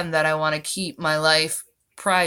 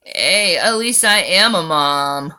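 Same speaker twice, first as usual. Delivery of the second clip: ordinary delivery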